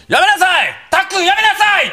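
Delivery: hammed-up dad yelling at kid; middle-aged man yelling. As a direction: call out to a son in a panic. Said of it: "おっさんの声で".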